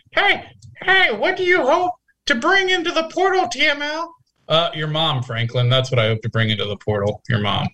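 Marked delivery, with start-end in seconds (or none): 0.1-4.1 very high squeaky nerd voice; mock the listener as a geeky nerd. Reading Franklin's question aloud, squeaky mocking nerd. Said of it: "the geeky nerd".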